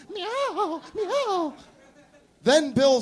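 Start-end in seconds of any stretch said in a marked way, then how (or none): 0.1-1.5 high, strained cat meows; screaming kitty talk. Acted. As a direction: scream like a cat through the lodged head. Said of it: "screamed the lady".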